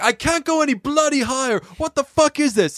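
a singer pushed past his limit; exasperated singer. Retold or imitated. Singer exasperated at producer's demand.

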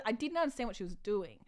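ordinary talk, nothing marked

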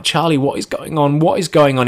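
high, urgent demand for answers; freaking out. Retold or imitated. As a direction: act out rising panic. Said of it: "I'm freaking out".

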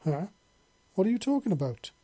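high-pitched, puzzled question; baffled, pitch jumping up; confused. A narrator's reading. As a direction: ask it in sudden bewilderment. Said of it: "and Alex looked confused".

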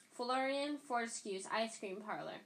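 ordinary delivery, nothing marked

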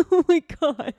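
high, loud laughing oh my God. Real, not acted. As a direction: laugh it out in disbelief. Laughing at the third betrayal.

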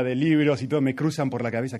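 usual speaking voice

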